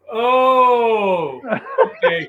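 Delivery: loud relieved "oh, okay"; a retold burst of relief; relieved surprise. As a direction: blurt it out as the fear drops away. Retold reaction to the ultrasound news.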